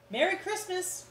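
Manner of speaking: high, bright holiday call; high cheerful shout; cheerful cry. Acted. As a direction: call it out merrily from the van. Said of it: "cried the delivery men".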